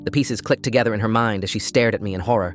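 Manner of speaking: plain reading voice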